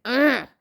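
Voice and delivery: loud, short "ugh" of frustration; aggravated groan. Retold or imitated. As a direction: groan it out, fed up. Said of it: "I'm just aggravated".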